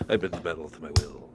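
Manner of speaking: low, soft villain catchphrase. Acted. Magneto repeating his only line, low quiet voice. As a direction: intone it deep and deadpan. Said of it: "That's all he just keeps saying".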